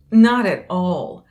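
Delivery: loud, slow, dramatic denial; big drawn-out insistence; emphatic denial. Acted. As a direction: protest loudly, stretching each word. Denies being overly dramatic.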